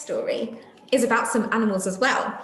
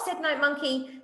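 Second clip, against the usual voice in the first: lively storybook reading voice; reading to children; 4 semitones higher than usual. A narrator's reading. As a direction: read it out like a picture book for little ones. Reading a picture book aloud to children.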